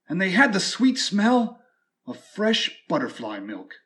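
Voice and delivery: plain reading voice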